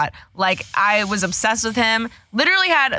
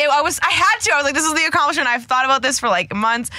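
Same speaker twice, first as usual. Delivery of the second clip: excited; 6 semitones higher than usual. Real, not acted. Excited retelling of hookup goal.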